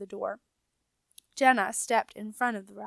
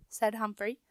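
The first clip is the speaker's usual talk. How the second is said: ordinary delivery, nothing marked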